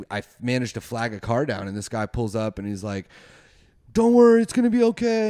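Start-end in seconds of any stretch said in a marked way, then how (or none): 3.9-5.3 high, loud, rushed rescuer voice; urgent reassurance. Retold or imitated. Rescuer calling to crash victims, urgent reassurance. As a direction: call it out while hurrying over.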